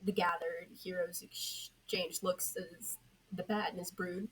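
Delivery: ordinary delivery, nothing marked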